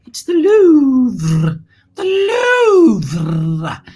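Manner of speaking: "Louvre" hugely stretched with a long rolled ending; grand French flourish. Pretend. Host announcing the famous museum, "Louvre" grandly drawn out. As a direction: draw out "Louvre" loudly and theatrically.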